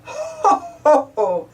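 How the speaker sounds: hammed-up ho ho ho; a big-daddy chuckle spoken out; jolly mock laugh. Daddy Pig laughing at Peppa, jolly spoken ho ho. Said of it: "Daddy Pig laughs".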